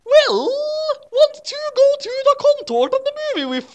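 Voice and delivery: hammed-up shrill cartoon devil; squeaky Satan. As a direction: play Satan as a silly high-pitched goth. Satan voiced by reader.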